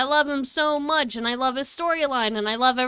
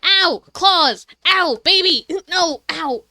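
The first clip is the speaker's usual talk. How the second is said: loud sudden yelps of pain; 6 semitones higher, 7 dB louder, slower than usual. Real, not acted. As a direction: yelp as claws dig in. Cat clawing her leg.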